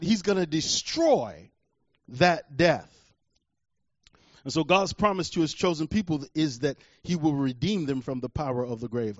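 ordinary delivery

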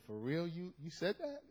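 incredulous question breaking into a laugh; soft, disbelieving, tipping into laughter; amused disbelief. Retold or imitated. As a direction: ask it softly, then crack up laughing. Laughs retelling his incredulous reply.

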